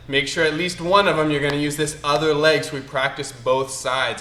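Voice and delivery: ordinary talk, nothing marked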